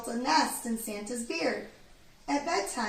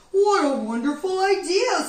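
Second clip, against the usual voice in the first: loud, higher joyful exclaim; delighted Santa; 7 semitones higher, 11 dB louder than usual. A narrator's reading. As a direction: Santa thrilled by the idea.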